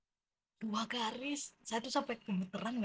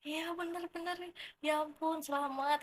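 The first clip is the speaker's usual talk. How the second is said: much higher and louder, squealing delight; gushing congratulations; 9 semitones higher, 3 dB louder than usual. Retold or imitated. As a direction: cheer for a friend's good news. Friend congratulating on pregnancy.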